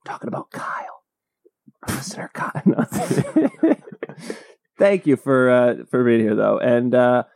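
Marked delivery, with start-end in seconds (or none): 0.0-2.6 hushed, confidential whisper; whispered aside. Pretend. Host names the listener in question, whispered aside. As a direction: whisper it as if letting a secret slip.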